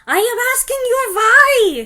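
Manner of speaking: put-on very high, loud accented scream; screaming witch. Grand High Witch screaming at silent audience, very high. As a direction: shriek the question at them. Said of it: "she screamed".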